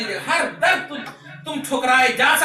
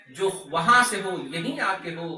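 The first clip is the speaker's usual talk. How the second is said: normal talking voice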